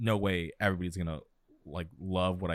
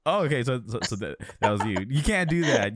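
higher, louder surprised outburst; 5 semitones higher, 6 dB louder than usual. Real, not acted. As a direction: caught off guard. Learns co-host replied to troll.

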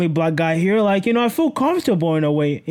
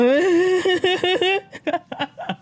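long, high-pitched giggle; real drawn-out laughing fit, pitched up; giddy laugh; 10 semitones higher than usual. Real, not acted. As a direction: crack up at your own flirting. Cracks up at own shy lines.

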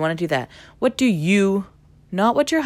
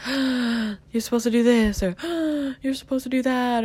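mock scandalized; 7 semitones higher than usual. Disapproving people telling her what she should do, mock scandalized. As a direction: gasp and imitate a scandalized busybody.

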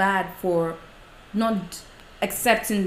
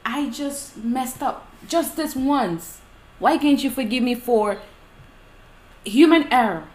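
raised-pitch plea, building louder; pleading; 4 semitones higher, 4 dB louder than usual. Acted. Someone begging a partner to forgive a slip, pleading. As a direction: plead it out, stressing each phrase.

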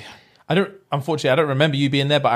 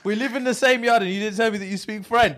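high-pitched aggrieved complaint; retold indignation; 7 semitones higher than usual. Housemate who never heard his friend spoke French, comic indignation. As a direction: protest like a betrayed housemate, playing it up. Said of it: "I was so angry".

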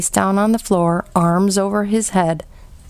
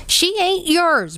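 screaming; 11 semitones higher, slower than usual. A narrator's reading. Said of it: "Bryce screamed".

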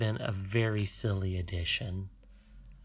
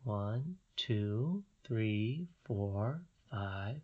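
quiet, gentle counting of toes; soft counting; 6 dB quieter, much slower than usual. Real, not acted. Counting child's toes softly.

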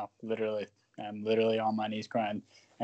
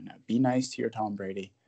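ordinary talk, nothing marked

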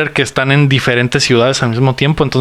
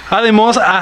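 trying not to laugh; 7 semitones higher than usual. Real, not acted. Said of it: "no puedo dejar de reírme".

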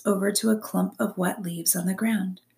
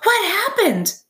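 high, loud, breathless fox; panting fox; 13 semitones higher, 6 dB louder than usual. A narrator's reading. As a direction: blurt it out like a winded fox. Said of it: "the fox panted".

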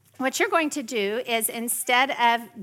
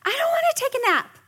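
much higher mock toddler whine; whiny preschooler; 4 dB louder than usual. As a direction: whine like a tired little kid.

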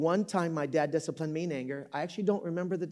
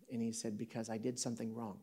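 soft, low retold admission; hushed confession; 5 semitones lower, 12 dB quieter than usual. Crying dad confessing to his son, hushed. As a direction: confess it quietly, voice dropped.

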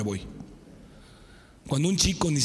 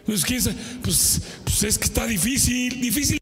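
mock hesitant young man, pitched up; whiny excuse voice; 5 semitones higher than usual. Preacher mocking a youth who makes excuses. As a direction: hem and haw like an unsure kid.

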